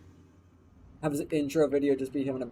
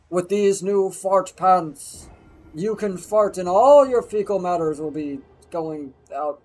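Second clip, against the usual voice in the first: mock product announcer; 4 semitones higher, 9 dB louder than usual. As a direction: proclaim it like a big product reveal. Mock announcement of fart pants.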